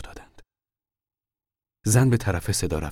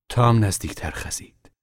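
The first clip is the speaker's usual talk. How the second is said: plain reading voice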